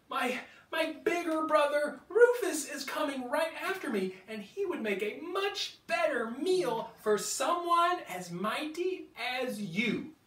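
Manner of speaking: high, eager little dino voice; little Stanley voice. Acted. Baby triceratops bargaining with the T-Rex, high voice. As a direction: talk your way out, small and quick.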